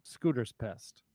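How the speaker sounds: ordinary talk, nothing marked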